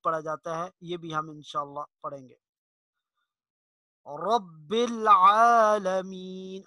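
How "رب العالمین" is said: رب العالمین slowly, the ع vowel drawn out; رب العالمین, hold the long vowel on العالمین; stretched ain. Shown as a demonstration. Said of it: "کھڑی مد ہے، اس کو تھوڑا سا کھینچیں گے".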